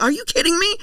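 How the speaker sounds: high, loud mock incredulity; indignant disbelief. Actor retelling his shock at covid testing, indignant and high. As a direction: imitate him getting all worked up. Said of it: "I was getting all like".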